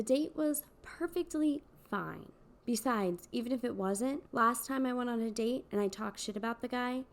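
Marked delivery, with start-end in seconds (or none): none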